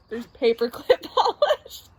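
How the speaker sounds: real laughter breaking up the words; laughing. Real, not acted. Reader can't get over paperclip polish, helpless laughter. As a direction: try to speak while cracking up.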